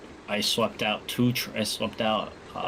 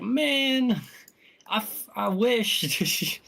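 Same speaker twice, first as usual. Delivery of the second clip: high mock whine; 13 semitones higher, 6 dB louder, much slower than usual. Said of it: "I was like".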